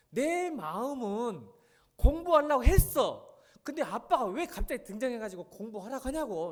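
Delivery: put-on teen griping about dad's nagging; indignant kid. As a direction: whine like a wronged child. Child venting at dad.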